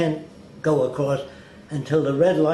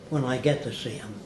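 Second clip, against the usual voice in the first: usual speaking voice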